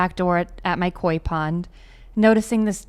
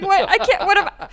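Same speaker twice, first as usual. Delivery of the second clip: high, helpless mock wail; mock crying; 11 semitones higher than usual. Pond owner helpless before the big bird, mock crying. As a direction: retell a tearful panic in a high voice.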